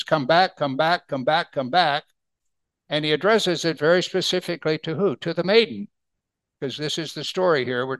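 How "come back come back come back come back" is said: each back leaned on, a light call; repeated for emphasis. Acted. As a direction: press come back each time. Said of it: "saying it four times for emphasis".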